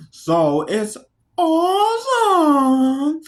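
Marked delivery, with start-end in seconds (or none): 1.4-3.2 high, long drawn-out word; stretched high exclamation of awe; hammed-up awe. Real, not acted. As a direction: sing out the word slowly, high. Exaggerated praise of the actor.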